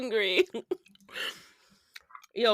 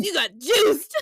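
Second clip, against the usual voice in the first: laughing taunt; 4 semitones higher, 4 dB louder than usual. Retold or imitated. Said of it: "And then he goes".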